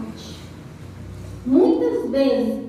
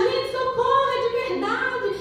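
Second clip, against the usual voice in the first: retold plea for help, much higher; desperate cry for help; 7 semitones higher than usual. Clown on the bench begging the laughing crowd, higher pleading shout. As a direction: push the clown's pitch up, pleading to be believed. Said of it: "começou a gritar".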